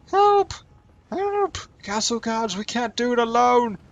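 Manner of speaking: very high, shrill yelling for help; panicked cry for help. Pretend. Voicing a fleeing soldier calling.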